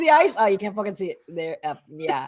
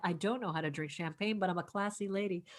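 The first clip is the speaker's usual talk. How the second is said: low, soft late-night radio host; 7 semitones lower, 12 dB quieter than usual. Pretend. Host playing classy with her champagne, AM radio voice. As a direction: drop low and hushed for AM radio. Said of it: "you just went into A M radio voice".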